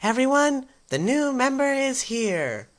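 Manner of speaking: energetic high-pitched schoolgirl; energetic girl. Acted. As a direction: announce it in a high, bubbly voice. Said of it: "full of energy".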